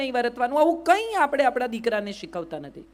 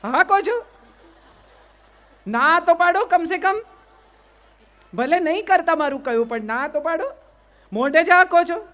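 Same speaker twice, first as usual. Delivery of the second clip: higher and louder, playfully goading the crowd; teasing challenge; 5 semitones higher, 3 dB louder than usual. Real, not acted. Speaker teasing the audience who nod yes, playful loud challenge. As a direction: poke at them: at least say no.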